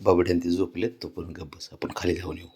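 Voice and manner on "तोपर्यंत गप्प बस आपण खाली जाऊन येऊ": hushed. Real, not acted. Hushing the cat, others sleeping.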